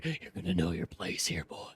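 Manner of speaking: low, hushed threat; menacing bully. Acted. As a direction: drop deep and quiet to intimidate. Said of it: "the man grabbed me by the balls and said".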